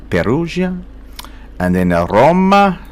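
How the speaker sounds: put-on Perugia said high in an over-the-top Italian accent; mock Italian accent. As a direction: roll Perugia out like a proud Italian. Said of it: "sounded vaguely European, that accent".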